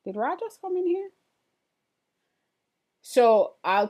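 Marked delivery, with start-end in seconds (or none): 0.1-1.1 quick, high startled question; suddenly spotting someone come in; quick surprised double take. Real, not acted. Noticing someone in the room.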